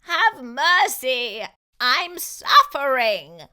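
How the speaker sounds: slow, loud, drawn-out wail; a toothache-stricken fox sobbing aloud. A narrator's reading. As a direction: plead for mercy in agony. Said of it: "the fox wailed".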